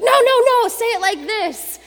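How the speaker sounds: very high, loud, insistent; demanding coach. Retold or imitated. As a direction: show him how, nearly shouting. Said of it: "and he's like".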